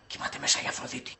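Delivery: near-whispered, low explanation; hushed. Acted. Man explaining that Aphrodite sleeps inside, hushed. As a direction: barely voice it, someone is asleep. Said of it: "Γιατί μιλάς σιγά".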